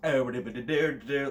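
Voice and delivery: loud nonsense-syllable Irish lilt; mock Irish accent. Shown as a demonstration.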